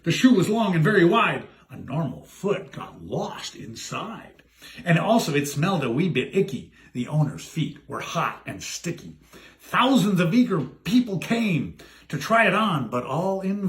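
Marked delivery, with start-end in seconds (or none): 1.7-4.4 slow, much softer and lower; hushed aside. A narrator's reading. Narrator hushes the story for a creepy detail, softly. 6.9-9.2 hushed disgust. A narrator's reading. Gross detail aside.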